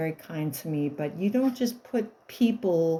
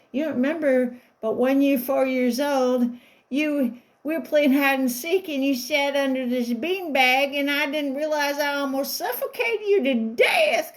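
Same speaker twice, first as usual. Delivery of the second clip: higher, louder, a lightly put-on babysitter; mock babysitter voice; 7 semitones higher, 4 dB louder than usual. Babysitter tells the grown child about the beanbag, lightly mocking. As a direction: replay the babysitter's breezy confession. Babysitter quoted telling story.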